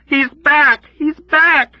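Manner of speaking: very high, loud screams; screaming. Acted. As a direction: keep screaming it in terror. Nurse Kramer screaming.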